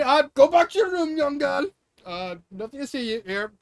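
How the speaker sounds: loud, high, hurried cover-up; mock panicked father hiding a fight; flustered dad shooing. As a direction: shoo the kid away, caught out. Voices father shooing daughter.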